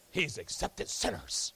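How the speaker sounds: low, slow mutter of the crowd; grumbling crowd. Acted.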